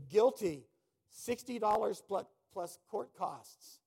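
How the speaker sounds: barked sentence, loud and high; judge's loud verdict. Retold or imitated. Preacher imitating an angry judge booming the verdict.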